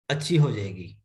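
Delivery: usual speaking voice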